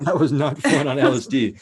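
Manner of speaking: laughing loudly through the words; can't hold back the laugh. Real, not acted. Telling friends about his bad acid trip, laughing out loud.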